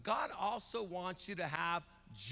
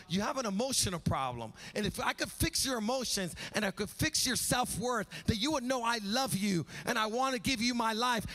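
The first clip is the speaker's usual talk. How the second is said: rising preaching; 4 semitones higher, 4 dB louder than usual. Acted. Preaching at full force as God.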